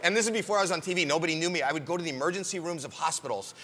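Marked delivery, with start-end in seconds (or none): none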